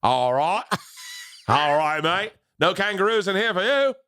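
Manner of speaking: put-on over-the-top Australian accent, laughing; hammed-up Australian. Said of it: "you just did Australia".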